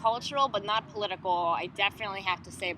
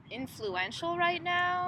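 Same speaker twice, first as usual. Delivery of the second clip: drawn-out musing; 9 semitones higher, much slower than usual. Real, not acted. Pondering question aloud.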